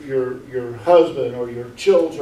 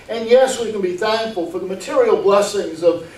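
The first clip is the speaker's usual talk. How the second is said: raised, emphatic pulpit delivery; higher, driving sermon voice; forceful preaching; 5 semitones higher, 3 dB louder than usual. Real, not acted. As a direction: preach it insistently, pitched up.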